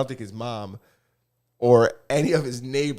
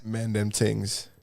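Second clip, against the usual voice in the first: mock Toronto accent; 3 semitones lower, 3 dB quieter, much slower than usual. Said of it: "mocking the Toronto accent".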